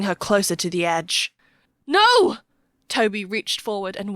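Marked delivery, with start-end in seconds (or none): 1.9-2.4 high, very loud scream of protest; horrified cry. A narrator's reading. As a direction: cry out in sudden horror. Child pushed toward the cliff edge.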